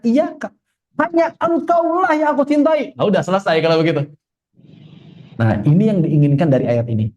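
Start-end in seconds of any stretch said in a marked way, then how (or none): 0.0-2.9 very high, hammed-up devotion; husband declaring love. Husband telling his wife she is the only one, hammed-up. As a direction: pitch it way up like a pleading lover. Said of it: "makanya didahulukan".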